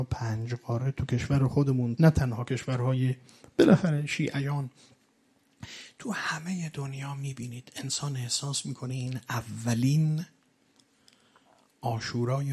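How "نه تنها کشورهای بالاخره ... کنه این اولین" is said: normal talking voice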